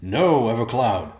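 loud, slow warning shout; booming. Acted. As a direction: boom it into the darkness.

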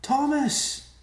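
very high, urgent shout of a name; piercing, high-pitched call. A narrator's reading. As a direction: cry the name out in alarm. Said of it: "she called".

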